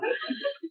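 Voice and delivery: a little high giggle; high laugh. Real, not acted. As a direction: squeal of laughter. Laughing at the shadow question.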